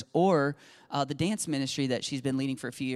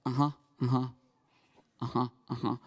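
checked out; 3 semitones lower, faster than usual. Retold or imitated. Daughter enduring dad's tithing sermon over lunch, checked out. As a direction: imitate someone tuning out a lecture. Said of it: "And she's checked out".

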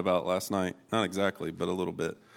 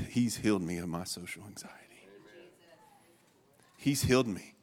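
usual speaking voice

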